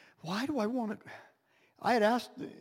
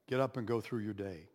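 usual speaking voice

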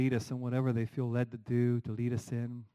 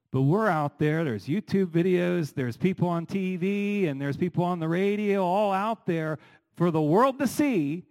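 louder and higher, building as the list goes on; rising preacher intensity; 6 semitones higher, 5 dB louder than usual. Real, not acted. Preacher rallying the congregation against public shaming, loud and climbing. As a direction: push each item up the scale, preaching hard.

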